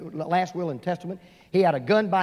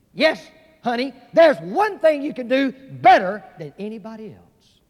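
rising preaching; 6 semitones higher, 6 dB louder than usual. Real, not acted. Preacher climaxing his sermon to the congregation, louder and rising. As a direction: build up, louder and higher, to the punchline.

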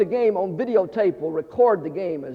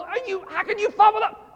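very high-pitched mock indignation; fan's outrage; 14 semitones higher than usual. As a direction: scold the player in a squeal of disbelief. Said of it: "amazing the difference it makes in my attitude".

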